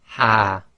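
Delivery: ha said as a soft breathy h from the bottom of the throat; a gentle, airy ha; the soft Arabic ha. Shown as a demonstration. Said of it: "the soft ha".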